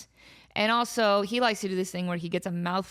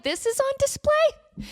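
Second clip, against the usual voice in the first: high, slow, baffled disbelief; baffled quote. Retold or imitated. Baffled at the zoo, seeing her own cat on display. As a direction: act out staring in disbelief. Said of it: "I'm like".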